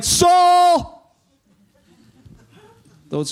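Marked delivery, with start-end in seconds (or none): 0.0-1.1 much higher, much louder shout of Saul!; booming call of Saul. Retold or imitated. Heavenly voice calling Saul, booming shout. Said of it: "When there's two, it's like".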